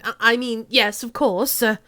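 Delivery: loud, stammering Cockney. Acted. Suspect changing his mind to the officer, loud Cockney. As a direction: backpedal loudly in a Cockney voice.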